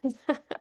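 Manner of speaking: a brief embarrassed laugh; short laugh. Real, not acted. Reader laughing off her tears after the story.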